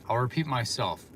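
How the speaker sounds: ordinary talk, nothing marked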